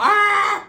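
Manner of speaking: high shouted yelp; sudden yelp. Retold or imitated. Aunt yelping out during the fight. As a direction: blurt a sharp cry.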